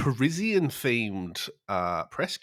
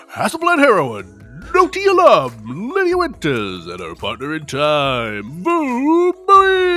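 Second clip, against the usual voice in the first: booming high ring announcer intro; wrestling announcer voice; 8 semitones higher, 12 dB louder, slower than usual. Shown as a demonstration. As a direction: belt out the names like a ring announcer. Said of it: "I'm gonna put on my sort of wrestling announcer voice".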